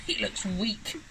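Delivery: understated grumble of disapproval; grumbling. A narrator's reading. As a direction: grumble mildly about the baby. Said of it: "and she grumbled".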